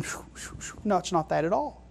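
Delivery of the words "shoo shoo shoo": soft, airy mock whoosh; quick puffs of voiceless air; whooshing noise. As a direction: imitate wispy spirits whooshing by. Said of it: "spirits in heaven that's going".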